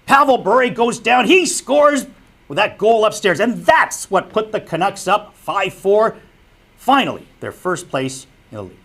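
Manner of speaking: high, loud, excited goal call; animated play-by-play. Shown as a demonstration. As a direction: call the goal like the crowd is on its feet. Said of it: "It was more like".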